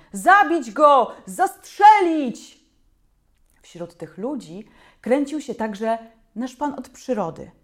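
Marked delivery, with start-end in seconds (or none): 0.1-2.7 loud, slow furious yells; angry mob. A narrator's reading. Mob with sticks demanding the beast die, furious yelling. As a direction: bellow it, out for blood.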